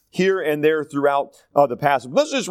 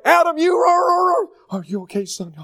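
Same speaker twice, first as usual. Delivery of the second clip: much higher, crying half-shout of a panicked father; frantic excited dad; 12 semitones higher, 4 dB louder than usual. Retold or imitated. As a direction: play the dad fussing wildly over his son. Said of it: "he's kind of an excitable person".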